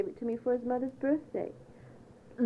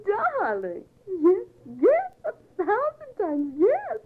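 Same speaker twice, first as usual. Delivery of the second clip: put-on girlish squeals; 9 semitones higher, slower than usual. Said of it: "your girlish squeals".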